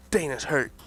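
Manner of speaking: ordinary talk, nothing marked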